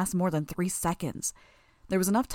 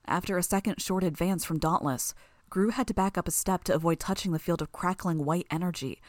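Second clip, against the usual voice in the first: ordinary delivery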